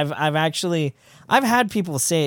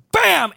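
gunshot bam; 13 semitones higher, 4 dB louder than usual. Retold or imitated. Imitating gunshot sound.

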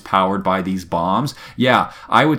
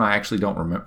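normal talking voice

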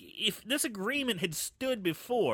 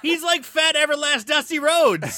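loud laughing exclamation; 9 semitones higher, 9 dB louder than usual. Real, not acted. Cracking up as he names Fat Everlast Dusty Rhodes, laughing loudly.

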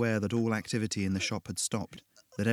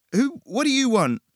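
terrified; 9 dB louder than usual. Acted. Cowering before the devil.

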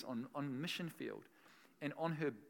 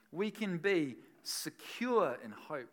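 slow emphatic repeat; 6 dB louder, slower than usual. Shown as a demonstration. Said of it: "repeated slower".

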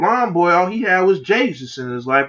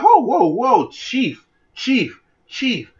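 mock alarm; 8 semitones higher, much slower than usual. Acted backing off from demon kid.

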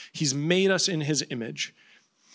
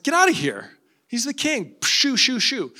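dismissive servant; 9 semitones higher than usual. Acted. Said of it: "one of Alexander's servants is like".

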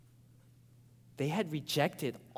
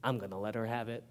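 fast, softer lower mutter to himself; muttered resolve; 6 semitones lower, 8 dB quieter, faster than usual. Retold or imitated. Pastor retelling his silent vow to confront Sonia. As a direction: mutter the threat under your breath. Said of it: "I told myself".

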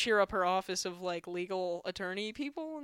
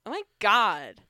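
loud, hammed-up comic's bit in a put-on voice; Paul Gregory impression; 3 semitones higher, 7 dB louder, slower than usual. Comedian parodying another comic's joke for co-hosts, mock-exasperated. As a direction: belt out a mock stage complaint in someone else's voice. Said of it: "here's me doing a Paul Gregory joke".

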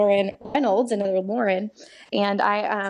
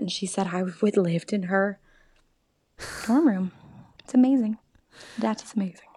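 ordinary talk

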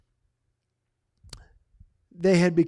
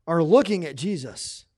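ordinary talk, nothing marked

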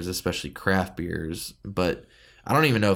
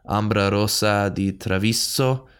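mock Italian; slower than usual. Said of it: "I sound very Italian when I say that".

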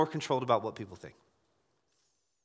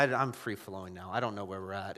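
ordinary talk

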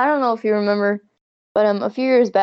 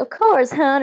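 light Southern drawl on hun; a hint of a friendly Southern clerk; Southern accent; 5 semitones higher, slower than usual. Gas station woman calls her hun, lightly Southern. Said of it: "in, like, a Southern accent".

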